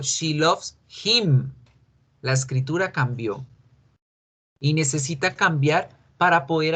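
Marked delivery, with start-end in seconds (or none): 0.0-1.5 slow, careful "she loves him" with a falling end on "him"; slow teacher demo of the right form; slow correct example. Shown as a demonstration. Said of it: "yo digo".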